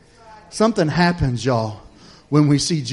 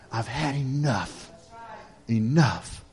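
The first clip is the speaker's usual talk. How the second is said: slow, quieter, weighted finality; resolute, fed up; 6 dB quieter, much slower than usual. Acted. Preacher asking who has been there, subdued resolve. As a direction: bring it right down, each word slow and settled. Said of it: "you ever been to that".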